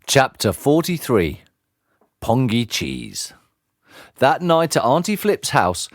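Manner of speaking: ordinary delivery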